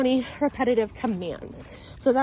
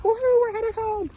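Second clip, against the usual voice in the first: voicing the dogs; 12 semitones higher, 4 dB louder than usual. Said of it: "They're like".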